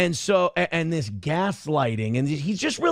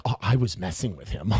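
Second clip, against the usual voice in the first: low, sneaky brush-off; a hint of a smug coward shrugging it off; lightly voiced sly brush-off, much lower; mock dismissive; 8 semitones lower than usual. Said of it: "he's like".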